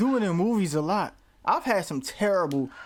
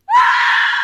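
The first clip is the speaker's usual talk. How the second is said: real scream, much higher and louder; a sudden shriek at a jump scare; startled scream; 12 dB louder than usual. Real, not acted. Reactor startled by the movie, real loud shriek. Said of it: "Your scream has an echo".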